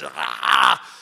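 deep growl like a bull demon; low demonic growl. Retold or imitated. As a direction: growl out the monster. Demon coming down from ceiling.

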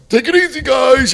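high sing-out goodbye, played up; breezy farewell. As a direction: call out a carefree send-off.

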